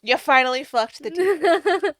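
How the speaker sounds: gleeful laughing cheer; laughing exclamation. Real, not acted. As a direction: crow it out gleefully while cracking up.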